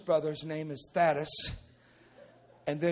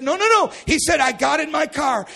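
high, loud protest; urgent correction; 11 semitones higher, 13 dB louder than usual. Acted. Brother correcting the preacher on the phone, urgent shout. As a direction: shout it to stop a wrong idea.

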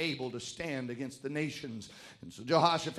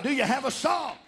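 raised, urgent preacher questioning; full-force preaching; 10 semitones higher, 6 dB louder than usual. Real, not acted. Preacher asking congregation if they have a song, full-force preaching.

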